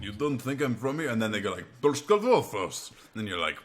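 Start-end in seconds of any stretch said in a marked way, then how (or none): 0.0-1.1 louder, higher mock Russian; Russian accent. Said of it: "or Russia". 1.8-2.9 high, loud, slow mock Russian; Russian accent. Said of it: "or Russia".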